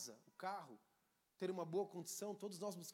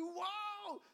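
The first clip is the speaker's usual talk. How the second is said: much higher, amazed; wow; 11 semitones higher than usual. Retold or imitated. Preacher recalling his amazement at a study. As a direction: gasp in wonder. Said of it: "eu falei".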